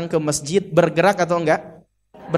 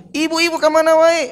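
high-pitched, expressive greeting to the ladies; animated calling out; 10 semitones higher, 3 dB louder than usual. Shown as a demonstration. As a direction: call out brightly with a big lift in pitch. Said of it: "Coba kalau tangannya gini". (sapaan ekspresif bernada tinggi)

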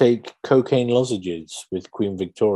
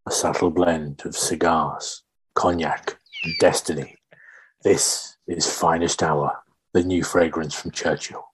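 sultry perfume ad; 5 semitones lower than usual. Acted. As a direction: sell it like a late-night fragrance ad. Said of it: "in the style of a perfume advert".

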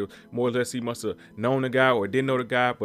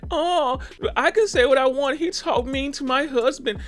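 mock entitled lady, higher and slow; mocking entitled fan; 11 semitones higher, slower than usual. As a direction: parrot her smug courtside complaint in a higher, slower voice.

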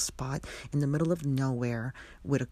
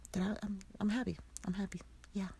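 softer, breaking up over happy words; close to tears; 6 semitones higher, 7 dB quieter, much faster than usual. Real, not acted. Mother speaking of her daughter, softer and near tears. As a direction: hold back tears as you say it. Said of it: "Let me not start crying now".

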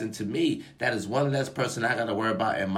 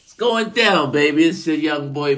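loud, high party yell; hype shout; 4 semitones higher, 8 dB louder than usual. Real, not acted. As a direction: shout it out to get the crowd going.

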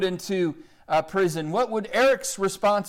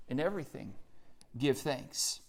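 soft quote; 4 semitones lower, 11 dB quieter than usual. Acted. Soft quoting of Paul.

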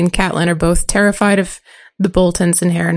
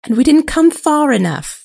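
put-on higher girl's voice, insisting; lightly voiced girl; 7 semitones higher than usual. Girl arguing with the boys over the distance. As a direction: push back gently as a girl. Reads Arya's lines.

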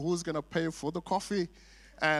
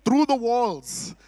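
loud and high, slowing right down on the last words; proud boast; 7 semitones higher, 8 dB louder, much slower than usual. Real, not acted. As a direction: brag about it with pride. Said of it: "I said it proudly".

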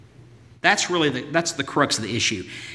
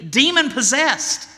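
high pleading cry; a desperate mother calling out for mercy; crying out woman; 12 semitones higher than usual. Acted. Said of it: "begins to cry out".

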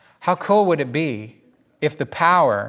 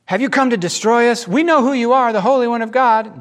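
high, raised-voice angry challenge; mad; 8 semitones higher, 5 dB louder than usual. Acted. Evil spirits challenge Jesus at church, mad. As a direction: confront him in angry alarm. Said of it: "So they're mad".

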